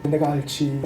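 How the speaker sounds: a quiet knowing reply; Jesus admitting he knows the pastor, quietly. Retold or imitated. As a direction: say it softly, as if you know him. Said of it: "좀 안다는 듯이".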